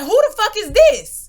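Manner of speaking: loud, high-pitched mock yell; retold angry demand. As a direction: imitate a furious mother demanding answers. Retold angry mother on the phone.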